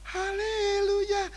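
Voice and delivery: haleluya sung out very high and drawn out to show off. Pretend. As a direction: stretch haleluya to sound impressive. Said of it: "pamer-pamer". (haleluya yang dipamerkan, tinggi dan panjang)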